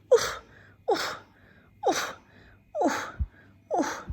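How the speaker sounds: loud effortful oofs; puffing oofs. Acted. Dog climbing over the crest, puffing oofs. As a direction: huff up the hill.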